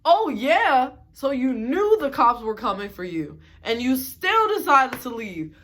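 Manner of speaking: incredulous. Real, not acted. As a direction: throw "Oh yeah?" back in loud disbelief. Incredulous reaction to roommate's story.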